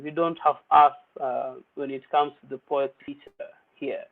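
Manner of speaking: ordinary delivery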